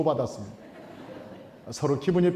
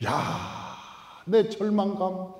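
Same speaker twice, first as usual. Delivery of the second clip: ordinary talk, nothing marked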